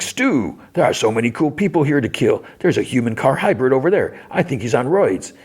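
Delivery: high-pitched, giddy greeting in character; excited rush-up. Retold or imitated. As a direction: gush like a thrilled killer showing a pal around. Sweet Tooth rushes up, thrilled.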